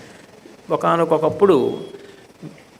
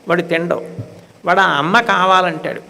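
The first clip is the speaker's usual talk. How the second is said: ordinary delivery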